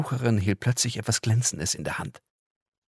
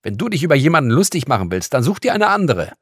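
loud, rising, faster furious scolding; angry; 6 semitones higher, 8 dB louder, faster than usual. Acted. Girl scolding the visitor for mocking her, angry and loud. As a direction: tell her off, climbing higher to the end. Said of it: "rief sie wütend".

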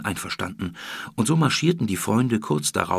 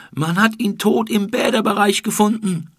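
loud, much higher scream; 12 semitones higher, 6 dB louder than usual. Acted. Someone in the crowd screams the news of the death. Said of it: "schrie jemand".

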